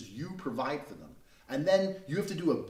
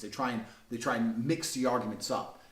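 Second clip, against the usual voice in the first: ordinary talk, nothing marked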